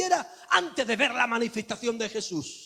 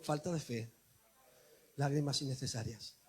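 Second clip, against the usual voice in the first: softer, lower repeat for all; soft lead-in refrain; 9 semitones lower, 11 dB quieter than usual. Shown as a demonstration. Preacher leading the church in a soft refrain. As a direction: say it softly with me. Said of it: "Di conmigo".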